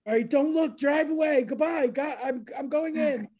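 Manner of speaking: high, loud rushed farewell; frantic goodbye. Retold or imitated. High frantic goodbye telling her friend to drive away. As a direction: send her off urgently.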